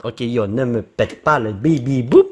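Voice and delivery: usual speaking voice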